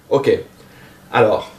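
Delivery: ordinary talk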